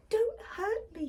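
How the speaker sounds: high, pleading mock whimper. Lion begs the little mouse, high mock whimper. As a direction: beg like a scared big cat, high up. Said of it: "he whimpered".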